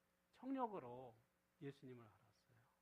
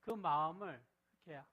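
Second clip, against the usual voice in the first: ordinary talk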